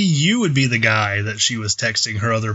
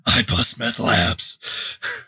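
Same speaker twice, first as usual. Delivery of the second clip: slow whispered Batman growl; gravelly Batman voice; 4 semitones lower, much slower than usual. Pretend.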